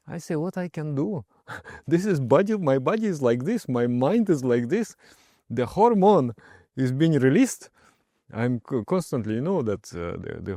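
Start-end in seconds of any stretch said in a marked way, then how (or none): 0.4-7.7 bubbly retold reply. Retelling his own cheerful reply.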